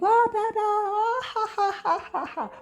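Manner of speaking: high squealing gibberish with laughter; mock loud couple. Mocking a loud quarrelling couple at home. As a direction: ham up a noisy shrieking couple. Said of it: "you would never hear, like, uh, shout".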